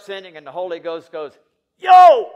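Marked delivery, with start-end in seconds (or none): 1.8-2.3 sudden high, very loud shout; shouted call. Acted. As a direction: yell it to stop someone in their tracks. Holy Ghost acted as calling out.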